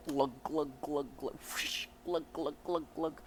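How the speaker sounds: rhythmic vocal glugs; spoken drinking sound effect; imitated gulping. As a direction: mimic chugging a drink.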